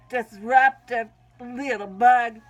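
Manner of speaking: ordinary delivery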